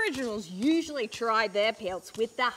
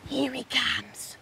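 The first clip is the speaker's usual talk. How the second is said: hushed whisper; 8 dB quieter than usual. Acted. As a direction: whisper so the animal won't hear. Host hushes near animal.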